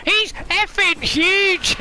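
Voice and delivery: mock terrified high yelps at a sea monster; scared chicken. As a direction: shriek like a frightened baby. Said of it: "He's going".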